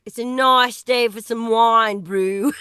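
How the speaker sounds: a broad Australian twang on "wine" and "nice"; Aussie-sounding accent. Shown as a demonstration. Imitating friend's Johannesburg accent, overshooting into Aussie. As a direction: Johannesburg English pushed too far into Aussie. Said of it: "you're going full Aussie there".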